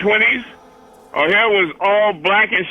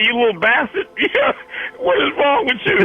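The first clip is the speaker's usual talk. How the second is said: laughing imagined retort; 6 semitones higher than usual. Retold or imitated. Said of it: "I wanted to say".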